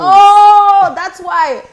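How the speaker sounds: high, loud oh of realization; a sudden "oh" that clicks; surprised. Real, not acted.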